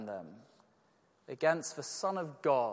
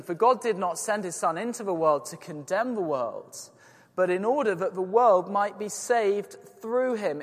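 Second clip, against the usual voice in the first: raised, ringing Bible reading; scripture reading; 5 semitones higher, 8 dB louder than usual. Acted. Preacher reading the verse to the church, raised and ringing. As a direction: proclaim the verse to the congregation.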